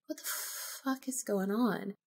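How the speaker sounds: ordinary talk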